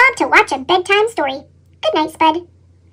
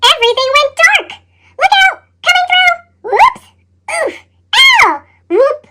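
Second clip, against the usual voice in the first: stumbling in the dark; 7 semitones higher than usual. Acted. Potato stumbling blindly through his dark room, yelping high. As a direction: cry out as you bump into things.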